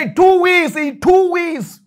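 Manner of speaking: high, loud preacher's declaration; emphatic promise. Acted. Pastor promises the wife results, loud high proclamation. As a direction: proclaim the deadline like a prophecy.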